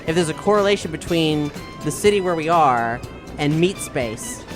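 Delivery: ordinary talk, nothing marked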